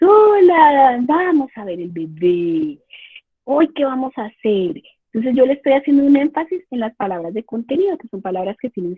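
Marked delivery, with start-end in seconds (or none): 0.0-4.8 motherese. Shown as a demonstration. Parent demonstrating maternés to a baby, sing-song and slow. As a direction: coo it like talking to a baby with marked prosody. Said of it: "hablamos en un tono que se llama ... el maternés".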